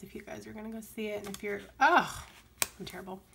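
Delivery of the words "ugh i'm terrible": ordinary talk, nothing marked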